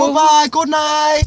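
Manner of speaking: very high, loud mock Taiwanese accent. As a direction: chirp the goodbye like a cheery host. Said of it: "I'll do Taiwan".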